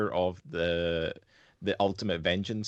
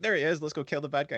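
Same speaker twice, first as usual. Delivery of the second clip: mock eager hero; 7 semitones higher, faster than usual. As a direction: ham up a player rushing the boss. Player charging at the final boss.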